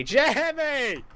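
high, loud call stretched out long; drawn-out call. Real, not acted. Gamer hailing his rival Jimmy, high drawn-out call. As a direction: call it out high, dragging the name.

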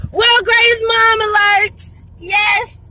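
high, loud, drawn-out alert call; gleeful, high-pitched proclamation; excited shout. Real, not acted. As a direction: shout it like a triumphant announcement. Excited self-congratulating announcement.

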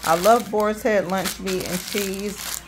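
ordinary talk, nothing marked

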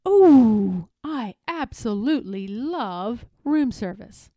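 loud swooning Oh; gushing delight. A narrator's reading. Eloise loving room service, gushing and emphatic. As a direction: savour it after a big sigh of joy.

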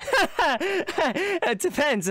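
high, forced, nervous laughter into words; awkward laughing. Acted. Friend dodging the guard's question, awkward laugh. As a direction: laugh too hard, stalling for time. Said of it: "awkwardly laughing".

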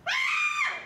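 sudden high, piercing scream; startled scream. Acted. Woman spots a dog in the room, startled scream. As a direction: scream in alarm.

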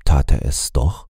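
ordinary delivery

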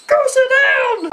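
high, loud, slow teacher command; mock strict teacher yelling at kids; imitating the teacher. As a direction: bark it like a stern daycare teacher. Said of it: "that's a good impression".

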